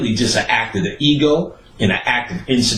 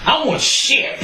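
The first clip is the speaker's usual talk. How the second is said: sulky sister; slower than usual. Retold or imitated. Sister snaps refusing food.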